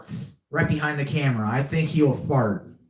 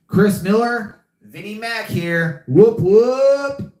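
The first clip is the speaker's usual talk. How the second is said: loud, much higher whoop; hype shout-out; 7 semitones higher, 5 dB louder, slower than usual. Real, not acted. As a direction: whoop it up for a buddy. Shout-out to a friend.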